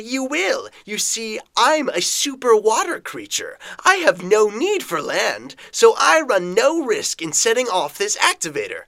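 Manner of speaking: high, sly taunting villain voice; sly water villain. Acted. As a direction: taunt the trapped hero slyly.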